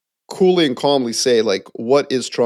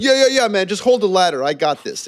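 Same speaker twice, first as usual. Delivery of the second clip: breezy Trump; 7 semitones higher than usual. Retold or imitated. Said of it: "He's like".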